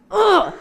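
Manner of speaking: loud, high frustrated "ugh"; exasperated groan. Retold or imitated. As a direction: let out a big annoyed groan. Exasperated reaction to the command.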